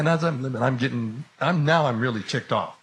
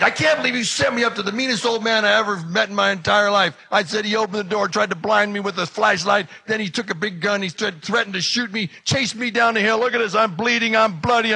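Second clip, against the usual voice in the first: loud, high-pitched tirade; furious rant; 8 semitones higher, 5 dB louder than usual. Acted. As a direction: unload every grievance on your friend. Rants at friend about old man.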